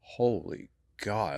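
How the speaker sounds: ordinary talk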